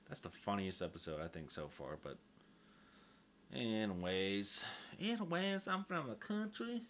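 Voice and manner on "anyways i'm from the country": country voice. Acted. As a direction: lay on a country accent. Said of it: "you guys get my country voice".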